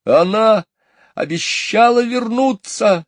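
high, loud desperate cry; a child insisting; high pleading shout; boy shouting. A narrator's reading. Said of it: "закричал он".

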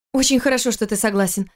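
ordinary delivery

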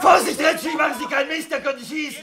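loud high urgent warning; alarmed shout. Acted. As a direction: yell to stop him getting shot. Warning before shots. (lauter, panischer Warnruf)

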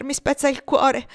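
più alta e più forte, straziata; heartbroken outcry. A narrator's reading. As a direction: gli si spezza la voce dal dolore. Padre straziato parla a Tom. (il padre straziato confida a Tom il suo dolore)

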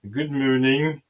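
hammed-up French accent; exaggerated French accent, comic; outrageous French accent. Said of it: "his outrageous French accent".

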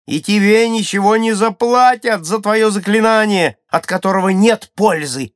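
loud, high scornful proclamation; Гуния. A narrator's reading. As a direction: declare it at a shout, pitched high. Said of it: "объявила Гуния". (громкое резкое заявление высоким голосом)

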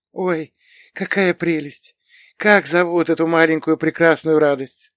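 put-on high-pitched old lady cooing over a dog; умилённая старушка. A narrator's reading. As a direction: gush tenderly in a high old woman's voice. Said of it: "полной щемящей нежности". (высокий голос умилённой старушки)

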